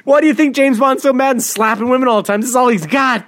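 high-pitched comic exasperation; worked-up joking tirade; mock rant. Mock rant about Bond.

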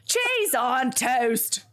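broad mock Cockney, drawn out; slow, hammed-up Cockney; Cockney accent. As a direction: stretch it into a cartoon Cockney. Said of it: "I immediately go Cockney".

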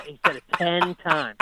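ordinary talk

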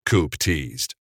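ordinary delivery

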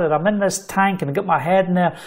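normal talking voice

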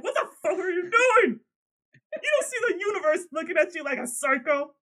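loud, high furious scolding like a parent; berating a child. Retold or imitated. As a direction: tear into her like a naughty kid. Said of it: "how you berate a child".

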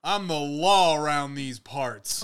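loud, slow, swaggering lawman voice; steady sheriff drawl. Retold or imitated. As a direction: puff up like a small-town sheriff. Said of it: "He would go".

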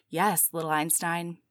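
low, indulgent lover's voice; lightly voiced seductive partner; robot lover. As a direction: drop low and slow down to praise.

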